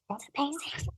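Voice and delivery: hushed, breathless amazement; whispered awe at being called the queen; awed hush. Retold or imitated. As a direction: barely voice it, stunned. Reacting in awe.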